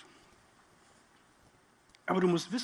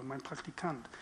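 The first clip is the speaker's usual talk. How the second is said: normal talking voice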